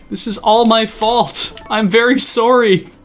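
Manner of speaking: high, loud mock wail of remorse; hammed-up apology. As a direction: apologize in over-the-top anguish. Wailed apology to unfed game animal.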